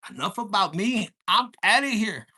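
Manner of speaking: a hammed-up loud goodbye; loud exit. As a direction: bellow it like storming off stage. Acted quote, dramatic exit line.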